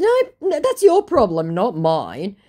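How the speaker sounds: high, loud brush-off; dismissive retort. Retold or imitated. Woman brushing off the scammer, dismissive. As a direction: hand the blame back emphatically.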